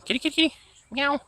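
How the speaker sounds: very high singsong call; high-pitched kitty call. Real, not acted. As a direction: call the cat sweetly, way up high. Calling the cat.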